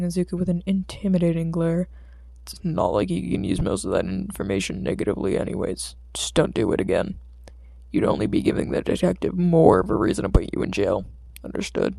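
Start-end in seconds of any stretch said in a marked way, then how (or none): 2.4-11.9 much lower, stern and level; deep Eraserhead voice. Acted. Stern underground hero warns Izuku, much lower voice. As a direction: play the tired pro hero warning the boy.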